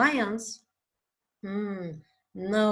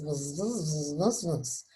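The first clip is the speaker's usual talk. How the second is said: bee buzzing; 7 semitones lower, 5 dB quieter than usual. Pretend. Bee picture, imitated buzzing.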